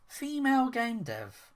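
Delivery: high, slow mocking echo. Mocking the developer's gender claim, slow and high.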